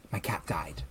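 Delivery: hushed whisper of the cashier's news; whispered retold line. Retelling the cashier's sad news, whispered.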